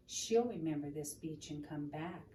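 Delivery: ordinary delivery, nothing marked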